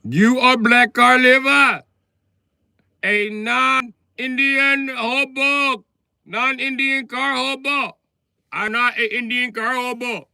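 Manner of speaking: very high, slow, loud put-on accent; hammed-up outraged foreigner; mock Indian heckler. Comic voicing a heckler's insult, very high mock accent.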